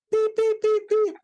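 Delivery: high, level mock beeps standing in for a swear word; censor bleep. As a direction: bleep out the insult with your voice. Bleeping out the coach's insult.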